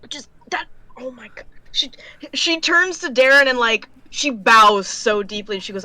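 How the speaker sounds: usual speaking voice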